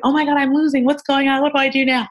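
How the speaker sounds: high, panicked rush; mock panic mid-bout; high panicky inner voice; freaking out. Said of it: "So I'm freaking out".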